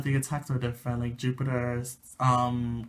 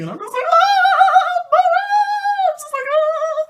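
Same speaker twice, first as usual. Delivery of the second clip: much higher, louder swooning gasp; Sailor Moon voice squeal; 13 dB louder than usual. Retold or imitated. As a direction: melt over her crush, squealing. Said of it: "screaming in my Sailor Moon voice".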